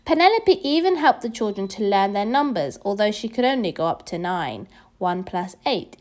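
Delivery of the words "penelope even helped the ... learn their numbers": ordinary delivery, nothing marked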